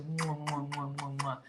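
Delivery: soft, smacking air kisses; a string of quiet mwah kisses; blown kisses. Pretend. Host sending a viewer kisses for a donation, soft kissing sounds. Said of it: "These kisses are just for you".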